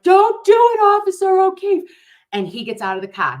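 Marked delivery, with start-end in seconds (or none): 0.0-1.8 high, loud warning cry; pleading shout. Pretend. Drone shouting a warning to the officer, high and loud. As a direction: yell down to stop him. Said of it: "So I'm like".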